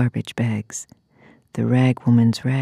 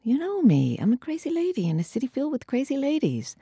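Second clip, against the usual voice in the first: rushed high refrain; 10 semitones higher, 4 dB quieter, faster than usual. Acted. Refrain, high fast quiet.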